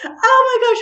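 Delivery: ordinary talk, nothing marked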